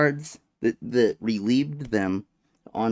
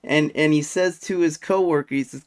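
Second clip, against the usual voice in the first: ordinary talk, nothing marked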